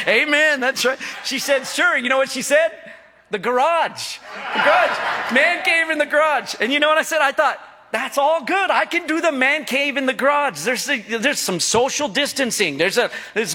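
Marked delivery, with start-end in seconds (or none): 1.7-2.0 higher, offhand agreement; wife's reply. Retold or imitated. Wife humoring husband about man cave, offhand. 3.3-6.5 higher, insistent mock wife. Wife insisting the man cave goes in the garage. Wife's firm retort, retold. 7.9-10.6 higher, cheerful agreement; happy agreement. Retold or imitated. Husband gladly accepting the garage man cave, cheerful. His own inner reply.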